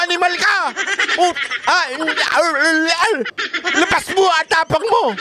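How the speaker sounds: high, mocking taunt of a bullying senator. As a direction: sneer it out high, goading him. (mataas at nangungutyang panunukso)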